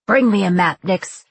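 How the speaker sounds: loud, urgent captain's command; barked order. A narrator's reading. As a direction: bark it at the crew.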